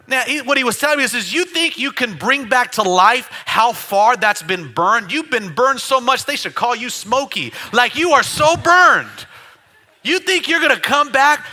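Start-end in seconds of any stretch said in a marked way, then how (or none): 1.3-11.4 loud mocking taunt. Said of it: "He's like".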